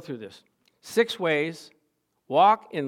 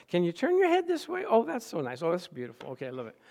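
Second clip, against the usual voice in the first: overly happy hygienist; faster than usual. Retold or imitated. Hygienist positioning a patient's head, mimicked as so happy. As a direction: imitate her fussing with delight. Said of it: "so happy about it".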